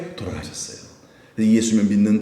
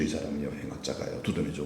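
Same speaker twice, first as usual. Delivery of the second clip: hushed aside; 9 semitones lower, 13 dB quieter than usual. Real, not acted. Hushed aside about thief.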